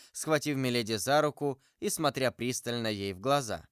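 plain reading voice

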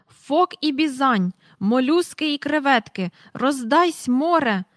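loud, slow booming sea oaths; thundering admiral. Acted. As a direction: roar like a captain in a gale. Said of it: "гримів адмірал". (гримить гучно й повільно, мов адмірал)

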